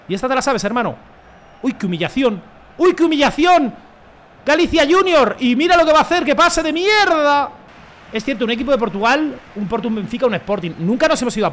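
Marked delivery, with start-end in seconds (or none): 2.8-7.5 loud, high commentator-like crowing; excited crowing. Real, not acted. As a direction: shout it, stretching out the last words. Celebra la jugada en voz alta. (narrando la jugada a gritos)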